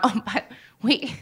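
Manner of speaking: normal talking voice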